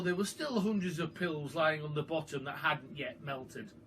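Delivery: ordinary delivery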